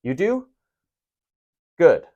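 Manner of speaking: "You do?" said flat, with no question rise; flat statement. Shown as a demonstration. Teacher showing the class it is not a question, flat. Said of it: "Don't even ask that as a question".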